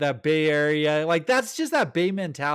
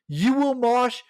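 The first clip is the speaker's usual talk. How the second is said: raised, louder mosh-pit order; imitated frontman's command; 6 semitones higher, 4 dB louder than usual. Frontman ordering the crowd to mosh, commanding shout.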